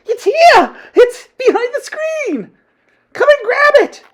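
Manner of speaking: very high, piercing witch shriek; triumphant yell. A narrator's reading. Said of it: "yelled triumphantly".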